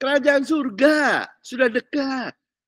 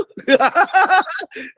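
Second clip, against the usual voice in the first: laughing burst; 6 semitones higher, 4 dB louder than usual. Real, not acted. Laughs at own mockery.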